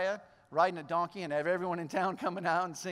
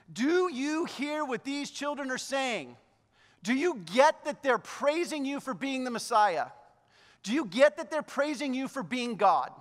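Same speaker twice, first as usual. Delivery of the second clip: higher-pitched, slow indignant questioning; 4 semitones higher than usual. Acted. Indignant leaders confronting Jesus over the children's praise, indignant. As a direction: challenge him, drawing out each question. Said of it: "The leaders were indignant".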